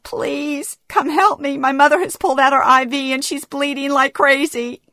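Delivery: high, panicked plea; urgent cry for a nurse, pitched high; frantic, high-pitched call for help; screaming for help. A narrator's reading. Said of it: "grab the assist button and scream".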